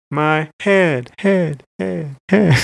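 word repeated as an echo; imitated big echo. Host mimicking a cover's echoed lyric, imitated echo effect. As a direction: bounce the last word like a homemade echo. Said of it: "you get the big echoes".

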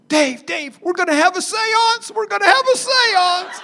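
high, loud panicked whisper-turned-cry, half laughing; wife urging him in panic about the seance, high and loud. Retold or imitated. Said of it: "She's in panic".

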